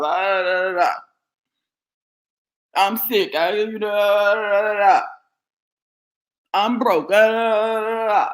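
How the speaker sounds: whiny sing-song mimic of a complainer, half-hearted; mock whining. Preacher mocks the congregation's excuses with a whine. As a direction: moan out the excuses in a mocking whine. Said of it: "sit around whining, moaning, groaning".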